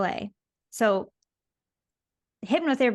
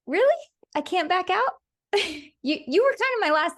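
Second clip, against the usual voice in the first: surprised; 9 semitones higher than usual. Retold or imitated. Reacting to husband's approval.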